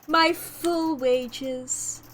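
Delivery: ordinary delivery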